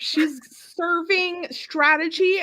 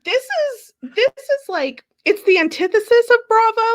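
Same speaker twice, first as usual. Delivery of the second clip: high-pitched, insistent protest; worked-up, high retelling of own reaction; exasperated retort; 7 semitones higher, 5 dB louder than usual. Retold or imitated. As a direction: rise into a loud incredulous objection. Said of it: "And I'm like".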